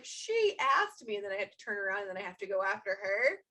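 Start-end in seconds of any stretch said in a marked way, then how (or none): 0.0-1.2 high, loud, drawn-out mock protest; protesting dad. Dad defending himself to mom, exaggerated protest.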